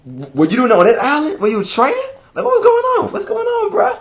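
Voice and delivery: high, quick, laughing through it; laughing question. Retold or imitated. Fan teasing Frankie, high laughing question. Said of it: "So I'm like".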